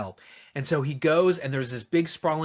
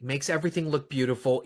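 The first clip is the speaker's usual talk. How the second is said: ordinary delivery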